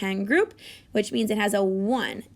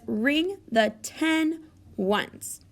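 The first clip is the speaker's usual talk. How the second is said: slow reading; 3 semitones higher, much slower than usual. Shown as a demonstration. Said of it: "teacher reads directions slowly".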